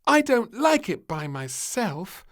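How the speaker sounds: put-on high, loud, slow wail of a little girl; crying child. Little girl wailing to her parents, high and loud. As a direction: cry it out like a sleepless child. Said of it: "cried little Princess".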